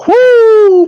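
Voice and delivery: high, loud woo of hype; excited whoop. Real, not acted. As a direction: whoop like the bell just rang. Hyped about the fight.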